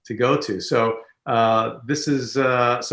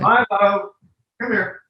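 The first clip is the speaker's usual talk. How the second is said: calling the dog; 8 semitones higher, 3 dB louder than usual. Real, not acted.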